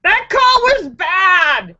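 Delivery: loud, slow, hammered-home protest; indignant shout. Real, not acted. Vlogger still outraged at the referee, slow loud shout. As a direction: bang out every word in disgust. Renewed rant about the call.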